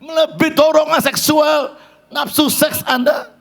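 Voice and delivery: high, impassioned preaching; preaching at full force. Acted. Preacher exhorting the congregation, impassioned and high. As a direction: rouse the congregation from the pulpit.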